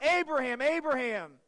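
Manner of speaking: angel yelling. Retold or imitated. Preacher voicing the angel halting Abraham, loud urgent call. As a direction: cry out to halt the knife. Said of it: "As he yells out".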